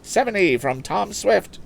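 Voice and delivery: high, brisk auction call selling the boat; auctioneer's patter. Acted. Auctioneer selling a motorboat to the lakeside crowd, high patter. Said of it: "cried the auctioneer".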